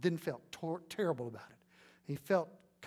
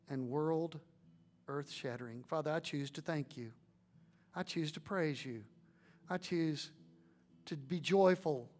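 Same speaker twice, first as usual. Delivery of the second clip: prayer. Acted. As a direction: pray humbly before the church. Leads a closing prayer.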